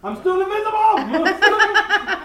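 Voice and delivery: high laughing exclamation; laughing shout. Real, not acted. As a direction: cry it out through laughter. Player laughing, shouting joke.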